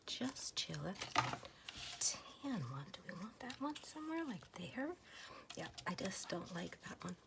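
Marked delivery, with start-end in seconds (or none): 0.1-4.9 hushed, breathy ASMR murmur; soft whisper. Acted. Crafter whispering to viewers while sorting stamps, soft whisper. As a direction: whisper it close to the mic.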